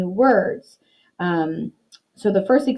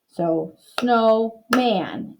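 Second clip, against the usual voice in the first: very slowly stretch out snow and man; slow blending; 2 semitones higher, much slower than usual. Shown as a demonstration. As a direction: draw the word out slowly, joining its two syllables. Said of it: "my second picture is a picture of a man".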